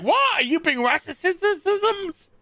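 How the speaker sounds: high squeaky disbelief, dragged out; shocked squeal. Retold or imitated. Neighbor asked if he's casing the joint, shocked high squeak. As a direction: act outraged and baffled.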